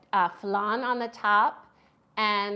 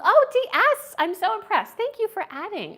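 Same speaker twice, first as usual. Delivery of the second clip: delighted; 11 semitones higher, 6 dB louder than usual. Real, not acted. Thanking a viewer, delighted.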